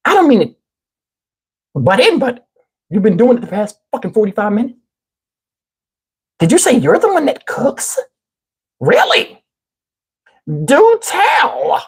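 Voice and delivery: high, mocking busybody voice; irritating woman. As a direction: mimic an annoying lady interrupting, pitch climbing. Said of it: "voice to people that irritate me".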